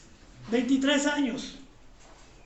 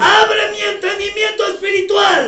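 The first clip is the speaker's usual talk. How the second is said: much louder, calling out each line; shouted prayer lead; 6 semitones higher, 10 dB louder than usual. Real, not acted. Pastor leads the standing congregation line by line, shouting. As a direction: shout the prayer for the church to echo.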